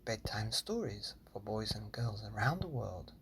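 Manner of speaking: hushed, gentle podcast welcome; soft bedtime host voice; soft bedtime intro. Acted. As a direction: ease the children toward bed.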